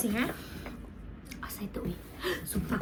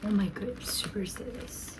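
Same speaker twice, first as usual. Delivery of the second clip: whisper; 5 semitones lower, 10 dB quieter than usual. Real, not acted. Hushed awe at item.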